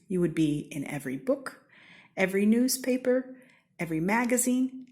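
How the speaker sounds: ordinary delivery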